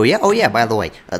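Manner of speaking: ordinary talk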